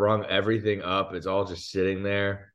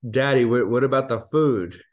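put-on louder, higher child's question; lightly voiced kid, raised and insistent; puzzled child; 5 semitones higher, 5 dB louder than usual. Child asking dad about abandoned groceries, puzzled.

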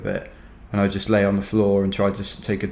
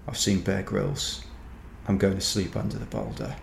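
private thought; 10 dB quieter than usual. Retold or imitated. Exhausted runner to himself on spotting a boulder, quiet private thought. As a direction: think aloud quietly, as if to himself.

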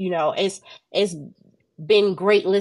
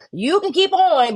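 defiant; 8 semitones higher, 4 dB louder than usual. Retold or imitated. Retelling her defiance to the devil.

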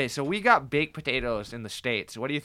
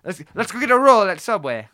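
higher, louder mock British accent; 6 semitones higher, 7 dB louder than usual. Friend trying out British words for a Subway order, mock British accent. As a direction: put on a bad British accent. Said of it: "a fucking British accent".

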